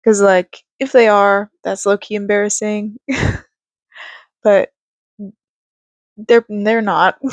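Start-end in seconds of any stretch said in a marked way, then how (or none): none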